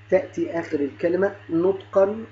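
ordinary talk

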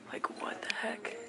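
breathy whispered disbelief, voice off. Real, not acted. Trying on a huge flannel, whispering to the camera. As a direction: whisper it like a secret in the fitting room.

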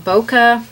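bokeh said as BOH-kuh; others' pronunciation. Retold or imitated. Painting teacher quoting how other people say it, bokeh as BOH-kuh. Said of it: "I have heard other people".

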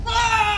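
loud, high scream breaking into laughter; shriek, then laugh hard; very high screaming cackle. Real, not acted.

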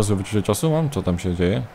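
usual speaking voice

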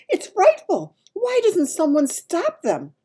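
horrified cry. A narrator's reading. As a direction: plead in horror as a little girl. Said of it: "Sophie cried".

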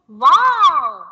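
loud, happy exclamation; a demo of joyful surprise; excited wow. Shown as a demonstration. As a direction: show delight at a clown. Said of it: "you're feeling very happy and you're expressing".

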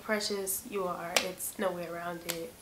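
soft, low-key talking to oneself; quietly convince yourself it's real; subdued inner voice; mental self-talk. Retold or imitated. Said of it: "trying to mentally tell myself".

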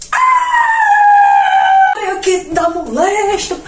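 very high, loud yelp, then a slow groaning complaint; pained shout. Retold or imitated. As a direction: scream as the hot sand burns your feet. Stepping on burning sand. (grito de dor, agudo e alto, depois lamento arrastado)

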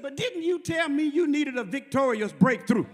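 insistent pastor. Retold or imitated. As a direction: press the question home with rising force. Pastor presses his question in retold call.

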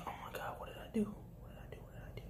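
panicked hushed whisper. Retold or imitated. Cashier whispering in panic after angry customer.

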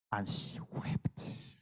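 slow, hushed whisper. Acted. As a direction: let the words fall to a whisper. Preacher hushes the scene.

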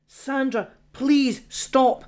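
slow pleading to stop her. Acted. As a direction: beg her gently to stop. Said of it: "he pleaded".

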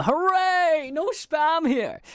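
high, slow mock celebration; hammed-up fake joy, drawn out; sarcastic hooray. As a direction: cheer ironically at nothing. Sarcastic cheer at empty spam.

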